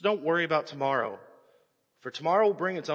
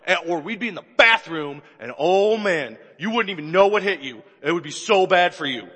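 put-on loud, looming warning to a child; mock parent threat; 7 dB louder than usual. Preacher playing dad scaring his kid, loud. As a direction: threaten the kid with big theatrical menace.

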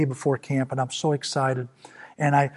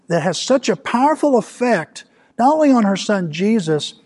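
raised, emphatic pulpit voice; building sermon fervor, pitched up and forceful; impassioned preaching; 5 semitones higher, 4 dB louder than usual. Real, not acted. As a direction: drive it home to the congregation.

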